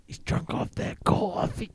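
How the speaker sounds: whispering. Pretend. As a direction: tease in a whisper. Copies the whisper joke.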